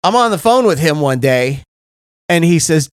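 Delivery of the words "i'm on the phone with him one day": ordinary delivery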